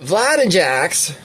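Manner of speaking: slow, swooping impression attempt; Leslie. Reader searching for a forgotten voice, slow put-on take. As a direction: stretch the names with a lilt. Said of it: "I forgot what he sounds like".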